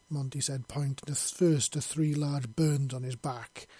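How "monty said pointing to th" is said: usual speaking voice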